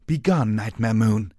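loud stern royal command. Acted. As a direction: banish her with authority. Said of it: "Celestia said sternly".

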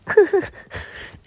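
short amused laugh; teasing laugh. Acted. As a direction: laugh at his shyness.

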